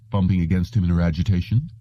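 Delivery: ordinary delivery, nothing marked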